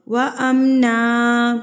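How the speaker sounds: slow tajweed chant; Quran recitation. Shown as a demonstration. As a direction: recite slowly and melodically. (تلاوت کا انداز)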